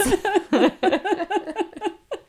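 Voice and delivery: a high, rising burst of laughter; high giggle. Real, not acted. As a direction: crack up at your own joke. Laughing at own joke.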